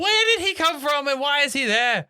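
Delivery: high, loud, bewildered question; baffled outcry. Real, not acted. Friend bewildered by John Hamm's character, loud and high. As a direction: throw up your hands in disbelief.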